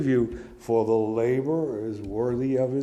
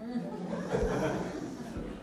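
breathy laugh; 11 semitones higher, 11 dB quieter than usual. Real, not acted. Laughing at the irony.

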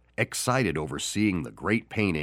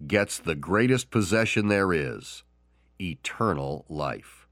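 usual speaking voice

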